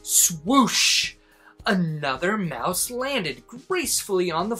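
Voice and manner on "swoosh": ordinary delivery, nothing marked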